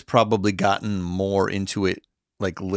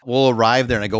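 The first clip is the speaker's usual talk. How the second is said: usual speaking voice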